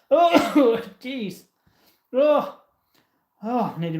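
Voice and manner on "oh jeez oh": coughing on dust. Real, not acted. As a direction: voice catching after a cough. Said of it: "Oh, I just inhaled dust".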